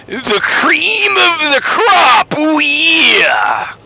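raspy, high wrestling promo shout; Macho Man Randy Savage. Retold or imitated. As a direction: growl it out like a hyped wrestler. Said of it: "as Macho Man Randy Savage would say".

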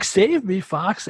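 high-pitched helpless sidekick; mock cry for rescue; Slippy Toad. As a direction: plead in a high cartoon voice. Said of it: "everybody loves Slippy Toad".

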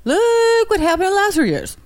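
imitating Obama. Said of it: "He goes like, he goes like this".

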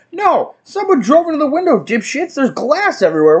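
high-pitched indignant rant; exasperated retort. Retold or imitated. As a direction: set an oblivious customer straight, voice jumping up. Exasperated retort to oblivious customers.

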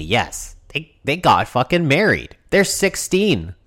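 normal talking voice

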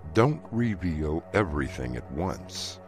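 ordinary talk, nothing marked

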